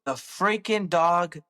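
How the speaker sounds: retold bad news, drawn out slowly; slow, halting confession of a disaster; grave confession. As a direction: spell out the bad news word by word. Dad retold breaking bad news.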